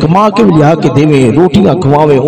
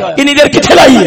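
higher-pitched nagging question; scolding parent; 9 semitones higher than usual. Acted. Parent to tired son back from work, scolding. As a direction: act out a parent demanding an explanation.

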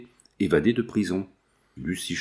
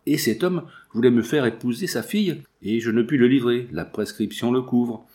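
usual speaking voice

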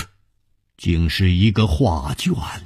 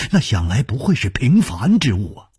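usual speaking voice

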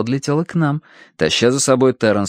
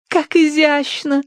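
high, drawn-out admiring gush; Tossie; slower than usual. A narrator's reading. Tossie who cannot stop admiring, gushing. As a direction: coo slowly, sky-high with rapture. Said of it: "не унималась Тосси".

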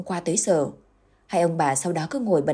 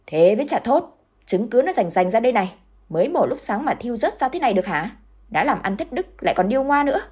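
higher, louder furious accusation; angry customer accusing the seller, loud and high-pitched; angry retort; 6 semitones higher, 3 dB louder than usual. A narrator's reading. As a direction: tear into her with scorn. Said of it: "mặt vẫn hầm hầm đáp lại".

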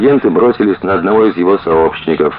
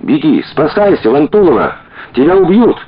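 wild scream; 6 semitones higher than usual. Acted. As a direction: scream a warning across the room. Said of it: "с диким воплем бросилась".

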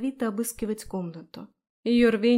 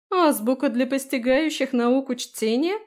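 loud, higher, incredulous scorn; loud scornful snort; 5 semitones higher, 6 dB louder than usual. A narrator's reading. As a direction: jeer as you read it out. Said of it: "громко фыркнула она".

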